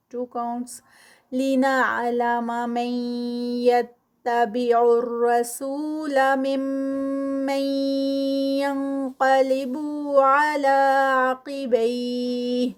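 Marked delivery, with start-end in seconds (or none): 1.3-12.7 very slow, louder chanted recitation with held nasal sounds; Quranic recitation. Shown as a demonstration. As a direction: recite slowly, letting the ghunna ring. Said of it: "یہاں پر ادغام ہے". (غنہ کے ساتھ آہستہ تلاوت)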